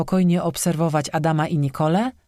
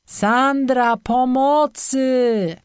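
higher, louder, slow cry of pain from below; pained cry for help; 8 semitones higher, 3 dB louder, much slower than usual. A narrator's reading. Boyfriend cries to Sandra for help in pain. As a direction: call for help in pain, dragging the words. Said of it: "bólem w głosie Patryka".

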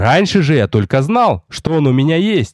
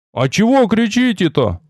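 high call, not truly loud; mild mock shout; loud curious shout; 7 semitones higher than usual. A narrator's reading. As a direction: call across the wall to ask. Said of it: "Столь же громким криком поинтересовался".

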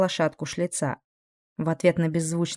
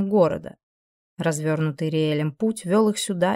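usual speaking voice

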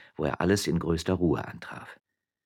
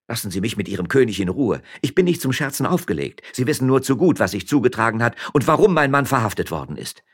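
higher, louder voice of an angry wife; indignant miller's wife; 6 semitones higher, 5 dB louder than usual. Acted. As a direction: rebuff him with raised pitch.

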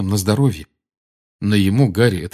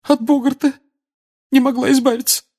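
very high, tearful trembling voice; 3 dB louder than usual. Acted. Mrs Weasley explaining the boggart to Harry, tearful and shaky. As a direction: quaver it out through tears. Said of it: "дрожащим голосом проговорила она".

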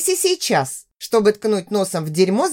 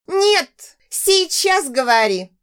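shrill squeal; 7 semitones higher, much slower than usual. Acted. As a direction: squeal it out at the top of the voice. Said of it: "взвизгнула из-за кресла незайка Соня".

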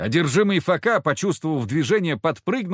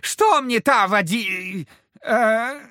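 high, slow cry breaking off; a demon suddenly unsure; puzzled devil; 8 semitones higher, much slower than usual. Acted.

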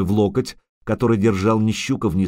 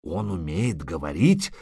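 soft, hoarse whispery gasp; hoarse wheeze; 4 semitones lower, 5 dB quieter than usual. A narrator's reading. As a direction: wheeze it out in disbelief. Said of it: "просипел Богольд".

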